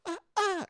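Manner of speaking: high, sharp grunting cries; tennis grunt impression. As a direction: mimic a tennis player's grunt on each hit. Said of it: "she sounds like".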